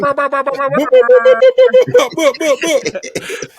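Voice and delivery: loud, high rapid-fire horn noise; imitated air horn. Host celebrating his wedding DJ, blaring mock air horn. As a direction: blare out a mouth-made air horn.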